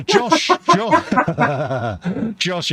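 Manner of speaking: high, loud call of a name through laughter; excited call. Real, not acted. Greeting Josh in the chat with a high laughing call.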